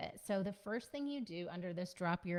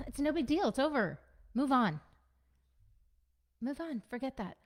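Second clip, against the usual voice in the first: higher, louder, brisk brushing aside; dismissive brush-off; 6 semitones higher, 5 dB louder than usual. Retold or imitated. Partner rushing past a crying person, dismissive and brisk. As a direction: hurry them past their feelings. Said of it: "and saying".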